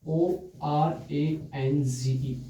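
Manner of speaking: teacher slowly spelling a word for students. Shown as a demonstration. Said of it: "मैंने बोला बच्चों".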